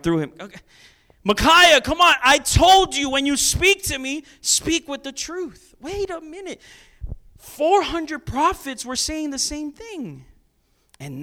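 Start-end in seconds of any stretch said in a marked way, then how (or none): 1.2-5.7 high, loud exasperated royal rebuke; angry king. Acted. King Ahab scolding Micaiah, high loud exasperation. 5.8-10.2 realizing king. Acted.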